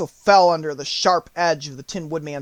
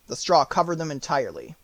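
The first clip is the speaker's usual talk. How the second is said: ordinary delivery, nothing marked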